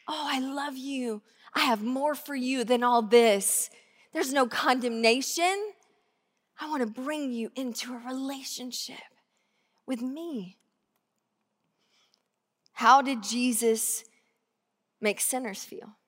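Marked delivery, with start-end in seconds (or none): 0.1-10.6 soft, tender and close; hushed voice of divine love. Acted. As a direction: speak love quietly. God's tender voice to sinner.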